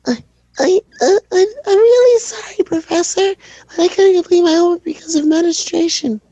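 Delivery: put-on very high, stammering near-tearful kid; shy kid voice. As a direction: squeak it out on the verge of tears. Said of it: "shy kid voice".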